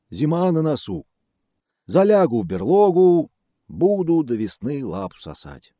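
loud, high sorry bear. Acted. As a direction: sigh it out like a bear. Bear resigned.

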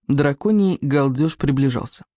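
plain reading voice

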